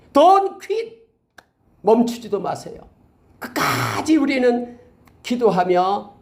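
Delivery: high, loud urging from the pulpit; rally the congregation not to give up; fervent preaching. Acted. Preacher urging the congregation never to give up, fervent preaching.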